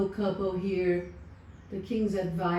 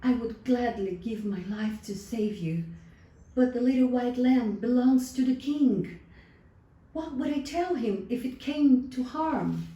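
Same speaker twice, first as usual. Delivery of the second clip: torn shepherd; 6 semitones higher, 5 dB louder than usual. A narrator's reading. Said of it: "he answered".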